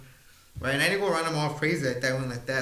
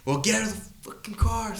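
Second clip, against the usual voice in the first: higher-pitched mimicked confrontation; mock angry demand; 7 semitones higher than usual. Man retells a driver challenging him, mock angry. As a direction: imitate an irritated driver ordering someone out. Retold roadside quarrel, man shouting.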